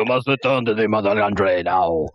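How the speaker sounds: put-on Russian accent, joining the prank; hammed-up Russian summons; mock Russian accent. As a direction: order it in a fake Russian accent. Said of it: "this is the motherland calling".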